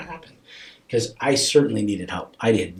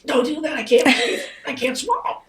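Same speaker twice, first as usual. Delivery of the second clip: very high, strained inner plea; mock choked panic; 3 dB louder than usual. Parent watching loved ones fall apart, acting choked-up panic. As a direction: squeeze it out as if about to cry, acted. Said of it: "they're falling apart. You're like".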